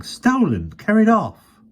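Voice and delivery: high and loud, desperate explaining; a scared boy trying to make himself understood. A narrator's reading.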